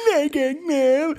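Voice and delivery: high, far-off laughing movie voice; laughing impression. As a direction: laugh through a goofy line. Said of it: "And he's like".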